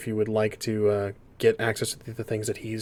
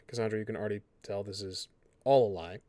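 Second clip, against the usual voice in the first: normal talking voice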